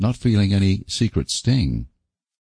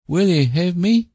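rustic suitor; 12 semitones higher than usual. Acted. Sam's abrupt marriage proposal.

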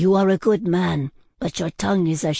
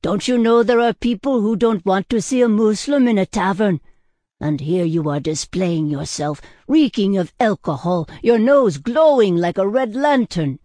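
raised, higher-pitched reproach; scolding; 5 semitones higher, 5 dB louder than usual. A narrator's reading. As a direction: tell the drunk off, building to a loud rebuke. Tavern owner scolds the drunk.

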